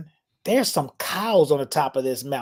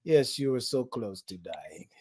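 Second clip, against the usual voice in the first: slow, deadpan imitated Austrian guide; matter-of-fact; 4 semitones lower, 9 dB quieter than usual. Guide casually confirms the near death, slow deadpan imitation. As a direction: drop it calmly and slowly. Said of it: "said so matter-of-factly".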